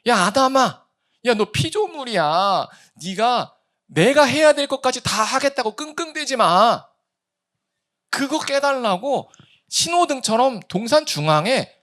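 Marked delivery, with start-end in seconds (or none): 0.1-6.8 higher, louder fatherly rebuke; God speaking to Adam. Retold or imitated. Preacher voices God telling Adam to stop straining. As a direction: gently scold a struggling son. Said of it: "하나님께서".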